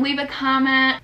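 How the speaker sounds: usual speaking voice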